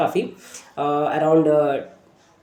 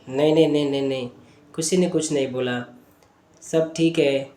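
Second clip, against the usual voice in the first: low, softer reluctant denial; evasive child; 4 semitones lower, 6 dB quieter than usual. Retold or imitated. Boy denying anyone said anything to him, subdued and evasive. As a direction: brush the question off quietly, haltingly. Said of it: "बार बार physical symptom बता रहा है".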